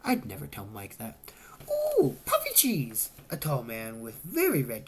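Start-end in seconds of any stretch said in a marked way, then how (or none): 1.7-3.1 high, slow, thrilled ooh; delighted squeal. A narrator's reading. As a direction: coo over the cheese puffs, pitched way up. Excited guest sees cheese puffs.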